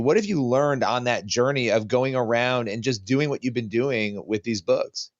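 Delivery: usual speaking voice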